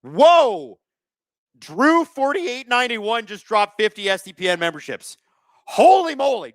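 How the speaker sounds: loud, high-pitched astonishment; amazed. Real, not acted. Streamer reacting to fifty gifted memberships, amazed and high-pitched. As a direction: burst out in real surprise.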